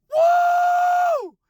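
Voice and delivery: high, loud whoop of joy; joyful whoop. Real, not acted. Celebrating a year sober on the ride home, big high whoop. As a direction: let out a big celebratory woo.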